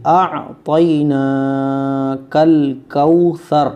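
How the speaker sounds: slow, loud teaching recitation; Quran recitation. Shown as a demonstration. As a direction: recite loudly, holding the long vowels.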